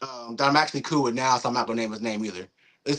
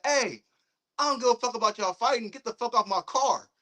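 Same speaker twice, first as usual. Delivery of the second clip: high-pitched, mock-indignant car owner; mildly angry retelling; 9 semitones higher than usual. Car owner to fighting kids, retold annoyance.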